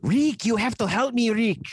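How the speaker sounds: high, loud pleading Peter Lorre; Peter Lorre impression. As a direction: beg Rick in a whiny Lorre voice. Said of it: "My Peter Lorre".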